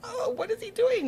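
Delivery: high, startled gasp of a question; alarmed mom. Retold or imitated. Mother alarmed at her son.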